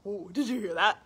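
usual speaking voice

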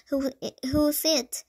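plain reading voice

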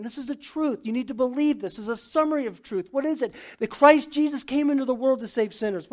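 loud, high, driving sermon delivery; fiery preaching. Real, not acted. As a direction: preach it hard to the congregation. Preaching at full force.

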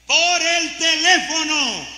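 high, loud, slow cry; preaching cry. Real, not acted. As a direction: cry it out with alarm. Preacher cries out.

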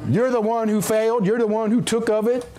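usual speaking voice

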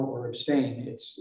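normal talking voice

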